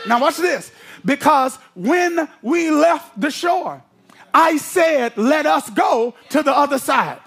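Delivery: full-force preaching. Acted. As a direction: preach it hard, building to a shout.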